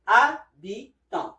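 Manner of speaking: "Habitant" slowly as a, bi, ton; silent H, syllable by syllable. Shown as a demonstration. Teacher models French for learners, slow "Habitant" with silent H. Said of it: "The H here is silent".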